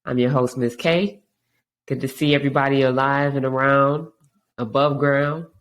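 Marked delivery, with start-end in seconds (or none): none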